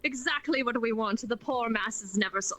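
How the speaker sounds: mock mustache-twirling villain, gloating with a "ha ha"; maniacal villain. Co-host mocking developers as schemers at a conference table, hammed-up villain. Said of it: "Twirling your maniacal mustaches going".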